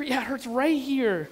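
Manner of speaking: a hint of a little-boy voice; young son. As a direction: imitate a child drawing out the answer. Son answers in retold dialogue.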